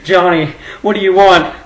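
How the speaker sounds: loud, high hammed-up scare; mock fright. Imagined garage scare, pleading. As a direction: plead with the voice in the dark. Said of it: "And you're like".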